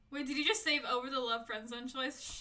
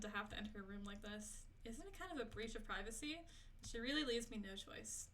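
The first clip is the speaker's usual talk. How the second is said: a touch of soft story reading, quieter than chat; reading game text; 8 dB quieter than usual. Acted. Streamer reading the game's narration to viewers, softly.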